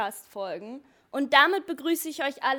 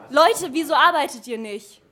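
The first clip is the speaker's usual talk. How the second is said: mock scolding call; 2 semitones higher, 9 dB louder than usual. Gespielter Chef ertappt Team.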